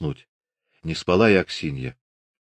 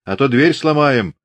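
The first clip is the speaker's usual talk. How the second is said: raised, higher-pitched demanding shout; booming shout; 8 semitones higher, 5 dB louder than usual. A narrator's reading. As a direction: call out loudly to people behind a door. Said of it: "зычно кричал".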